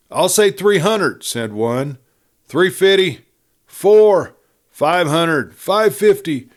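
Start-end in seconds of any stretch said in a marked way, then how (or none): 0.1-1.2 raised, loud auction call; calling out a bid. Acted. A rancher bids loudly for the girl's horse. 2.5-6.5 raised, loud auction call; calling out a bid. Acted. A rancher bids loudly for the girl's horse.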